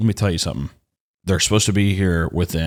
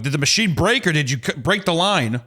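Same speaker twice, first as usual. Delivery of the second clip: higher-pitched mock interrogation; frustrated questioning; 6 semitones higher than usual. Customer cornering the worker over the cut fiber, frustrated. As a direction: spell out the choice in exasperation. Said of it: "So I'm frustrated".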